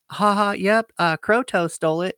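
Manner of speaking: high, loud snickering clerk laughing at him; smug clerk chuckling as he says the car was towed; mocking clerk. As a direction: play the smug office worker who finds it funny. Office clerk mocking him on phone.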